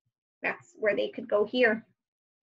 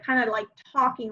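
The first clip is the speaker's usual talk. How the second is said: normal talking voice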